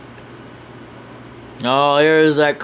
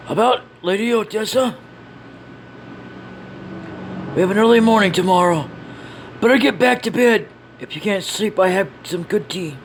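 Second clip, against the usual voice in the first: concerned companion; 7 semitones higher than usual. Acted. Companion sends brooding character to bed.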